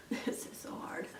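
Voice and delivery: whispered, barely voiced; hushed aside. Real, not acted. Performer admits this is so hard, whispering. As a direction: whisper it under your breath.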